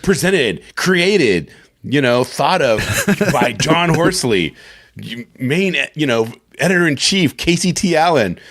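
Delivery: high, punched-up title-card announcement; hammed-up opening-credits voice; mock credits announcer. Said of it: "Here it's like".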